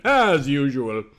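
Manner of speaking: ordinary delivery, nothing marked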